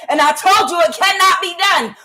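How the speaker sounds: told-you-so outburst; exasperated scolding. Real, not acted. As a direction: lay down a loud, high warning.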